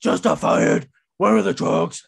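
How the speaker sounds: loud, hammed-up demanding yell; mock angry shout. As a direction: bark it like an angry champion. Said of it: "He starts going".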